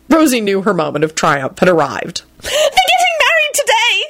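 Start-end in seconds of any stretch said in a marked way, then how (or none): 2.4-4.0 very high, gleeful squeal; excited yell. A narrator's reading. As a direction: squeal the big news triumphantly. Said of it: "she yelled and clapped her hands excitedly".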